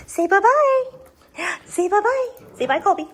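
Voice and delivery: high, loud sing-song bye-bye. Real, not acted. Owner prompting puppy Colby to say bye in high baby talk. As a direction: coax the pup to wave bye.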